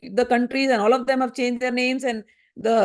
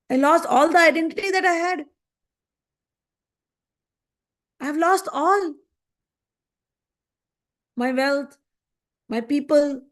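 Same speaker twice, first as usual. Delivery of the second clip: raised, pleading lament over a lost family; grieving king's lament; 3 semitones higher than usual. A narrator's reading. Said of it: "he started crying".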